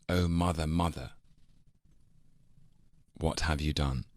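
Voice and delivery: long pause after mother, before what have you done; written pause. Shown as a demonstration. Actor showing Coriolanus pause, long silence after mother. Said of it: "Shakespeare writes a pause in".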